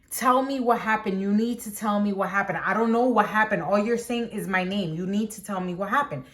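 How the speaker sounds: ordinary talk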